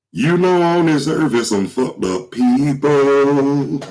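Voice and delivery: high, slow crooning imitation; sing-speak like a soul crooner. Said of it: "I hope [name] don't kill me".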